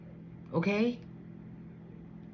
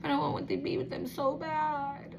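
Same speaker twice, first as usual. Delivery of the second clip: soft, higher-pitched yearning; lovestruck longing; 6 semitones higher, 6 dB quieter than usual. Acted. This person secretly wanting to be with them, yearning. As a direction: ache for them in a small raised voice. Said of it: "this person just looks like".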